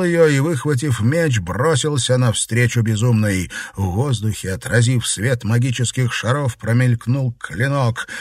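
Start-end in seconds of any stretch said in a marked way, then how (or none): none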